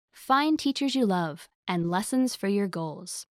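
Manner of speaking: ordinary talk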